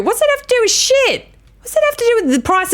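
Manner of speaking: high, incredulous retort; indignant retort. Real, not acted. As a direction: throw it back in disbelief.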